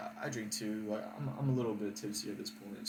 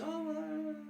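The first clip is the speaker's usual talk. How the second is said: long, very high whiny 'oh'; high-pitched mock excuse; mocking the date. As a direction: mimic her with a squeaky drawn-out note.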